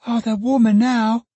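put-on a small child piping up eagerly; child exclaiming. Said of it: "exclaimed the child".

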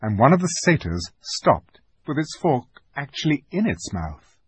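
usual speaking voice

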